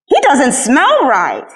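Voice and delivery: slow, lifted in disgusted surprise; surprised exclamation. A narrator's reading. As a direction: sniff it out, slowly and surprised. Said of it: "he exclaimed".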